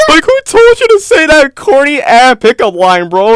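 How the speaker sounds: high, loud, laughing through the words; can't keep a straight face; laughing disbelief. Real, not acted.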